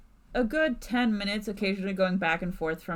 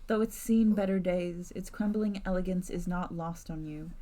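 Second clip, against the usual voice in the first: measured narrator voice; story narration. A narrator's reading. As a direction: set the scene like a storyteller. GM narrates scene.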